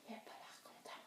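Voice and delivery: whispered storytelling aside; barely audible, conspiratorial whisper; hushed aside. Acted. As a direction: whisper it as a dreadful understatement. Bear has just woken up.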